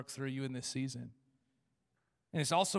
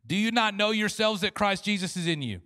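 louder, higher proclamation; preaching scripture; 4 semitones higher, 6 dB louder than usual. Acted. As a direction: proclaim the question.

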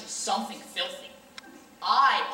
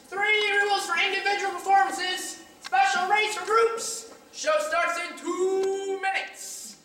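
much higher, louder barker's pitch; carnival barker call; 11 semitones higher, 3 dB louder than usual. Acted. Showman announcing prices to passers-by, much higher barker voice. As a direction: sell the show like a sideshow barker.

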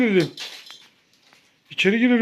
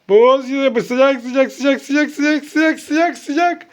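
repeated chant climbing ever higher; mounting excitement; 4 semitones higher, much slower than usual. Pretend. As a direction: raise the pitch step by step as she nears it. Hot-cold game, child getting closer.

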